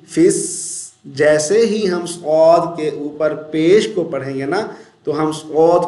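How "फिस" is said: फिस with a long hissed s; whistling sound. Shown as a demonstration. Teacher shows fa joined to sad, फिस whistled. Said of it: "तो सीटी की आवाज़ आएगी".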